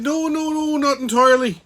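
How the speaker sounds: hammed-up high-pitched denial; protesting Irish charmer. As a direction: plead innocence with a high lilt. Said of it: "I said".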